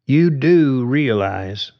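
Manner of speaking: soft and slow. A narrator's reading. Carly calmly warning George high above the ground, slow. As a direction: draw the words out calmly. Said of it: "soft and slow".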